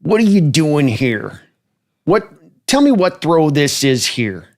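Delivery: gruff, slow coach bark; lightly voiced Gruden grilling a quarterback; John Gruden impression. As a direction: channel a grumpy NFL coach grilling a rookie. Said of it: "let me hear a John Gruden impression".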